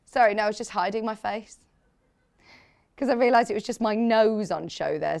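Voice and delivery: ordinary delivery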